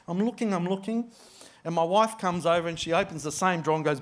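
ordinary talk

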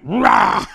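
loud, high mock dog bark. Preacher barking back at the dog, loud. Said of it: "And I barked at the dog".

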